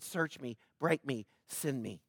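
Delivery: very soft, low surrender; hushed prayer. Retold or imitated. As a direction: breathe it like a private prayer. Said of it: "Because I said".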